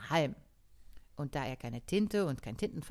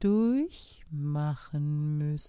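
slow, loud and lazy snail; sluggish snail; 7 dB louder, much slower than usual. Acted. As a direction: drag it out, ignoring the hurry. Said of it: "mit ihrer gewohnten Trägheit".

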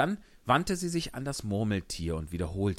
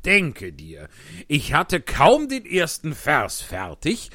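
loud, excited Hatter voice; 8 semitones higher, 10 dB louder, slower than usual. Acted. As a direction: tell it like an agitated Hatter. Hutmacher als Figur. (lauter, aufgeregter Hutmacher)

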